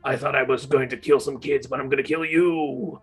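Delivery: low-voiced, grumbling menace; put-on low voice of an annoyed axeman; angry executioner. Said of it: "looking kinda, like, angry, being like".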